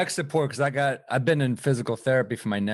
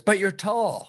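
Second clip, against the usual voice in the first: higher-pitched mock mom retort; dismissive mom; 6 semitones higher, slower than usual. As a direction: brush it off like an unsympathetic mother. Mom dismisses his depression.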